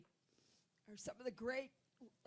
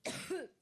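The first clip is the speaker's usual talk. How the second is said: chesty cough; 6 semitones higher than usual. Real, not acted. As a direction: cough it out hard. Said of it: "my allergic reaction".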